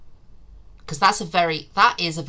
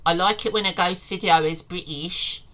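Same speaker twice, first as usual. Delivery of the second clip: normal talking voice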